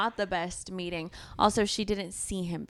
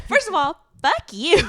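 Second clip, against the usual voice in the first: higher, louder sassy comeback; mock retort; 9 semitones higher, 9 dB louder than usual. Friend voices the heroine snapping at her rival, loud sass. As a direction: snap it back with glee. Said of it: "she's like".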